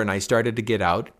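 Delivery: usual speaking voice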